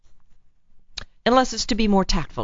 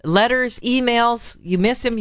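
ordinary talk, nothing marked

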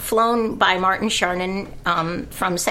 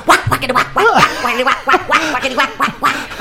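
tap dance sounds; 5 semitones higher, 5 dB louder, faster than usual. Retold or imitated. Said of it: "we hear".

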